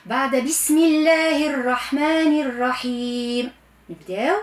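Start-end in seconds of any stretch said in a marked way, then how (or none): none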